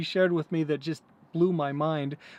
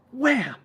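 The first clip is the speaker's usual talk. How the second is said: door slam; 8 semitones higher, 5 dB louder than usual. Retold or imitated. Door slamming in retold commercial.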